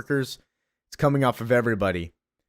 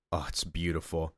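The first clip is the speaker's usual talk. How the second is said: hushed awe; 6 semitones lower, 10 dB quieter than usual. Real, not acted. Awed by the comic art.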